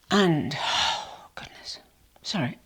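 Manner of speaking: real breathless sigh, words trailing off; out of breath. Real, not acted. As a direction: puff out a sigh, then apologise. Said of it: "hence out of breath".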